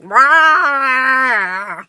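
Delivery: one loud mock roar from the stomach; stomach growl. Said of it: "And Jelly is like".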